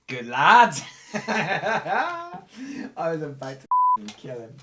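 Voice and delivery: loud cheer breaking into laughter; real, raucous laughing praise; delighted approval. Real, not acted. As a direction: burst out laughing with relief, high and loud. Game master delighted by player's move.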